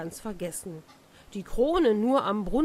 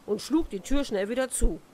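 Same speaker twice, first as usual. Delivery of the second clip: usual speaking voice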